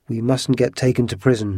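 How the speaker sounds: plain reading voice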